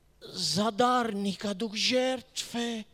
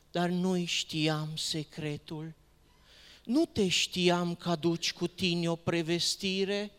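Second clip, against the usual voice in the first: ordinary delivery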